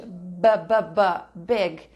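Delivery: a crisp "b" sound, then "big"; consonant sound. Shown as a demonstration. Teacher showing students the opening "b" sound. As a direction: tap out the "b" before the word. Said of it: "Big começa com som de consoante".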